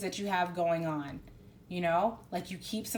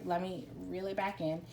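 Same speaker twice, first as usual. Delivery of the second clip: usual speaking voice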